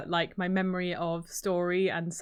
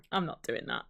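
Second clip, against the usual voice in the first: mock refusal; 8 semitones lower, 7 dB quieter than usual. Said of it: "they're going".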